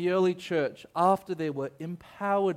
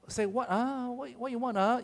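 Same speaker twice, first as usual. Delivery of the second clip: high-pitched Singaporean auntie; Singaporean auntie accent; 9 semitones higher than usual. Acted. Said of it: "one of those really Singaporean".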